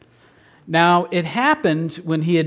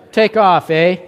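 a light Canadian "Take off, eh?" with a lifted "eh"; playful hint of a Canadian accent; 3 semitones higher, 3 dB louder than usual. Shown as a demonstration. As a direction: toss off a mock Canadian "take off, eh". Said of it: "In honor of our Canadians".

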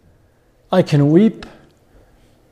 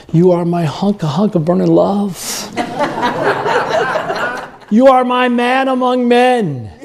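imagined wife gushing, higher and laughing; adoring wife; 5 semitones higher than usual. Pretend. A husband voicing his wife's adoring words, laughing. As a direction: play the smitten wife, giggling. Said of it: "I want words like".

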